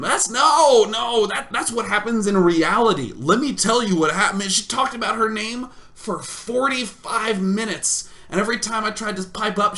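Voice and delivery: high, loud exasperated insistence; mock indignant protest. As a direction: protest loudly, playing up the outrage. Mock indignant protest about the date.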